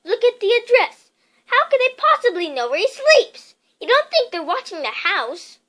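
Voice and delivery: high, loud anxious pleading; frightened wife. A narrator's reading. Aunt Petunia frets to her husband, frightened. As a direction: fret aloud in fear.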